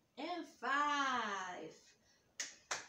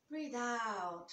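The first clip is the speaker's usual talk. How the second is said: ordinary talk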